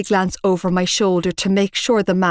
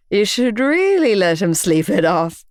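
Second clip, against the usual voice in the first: snickering. Acted. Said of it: "Shane offered with a snicker".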